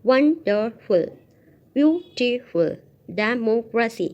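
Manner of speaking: "wonderful", "beautiful", "democracy" broken into separate syllables; teacher's loud, segmented reading of "wonderful", "beautiful", "democracy". Shown as a demonstration. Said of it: "Words with more than two syllable".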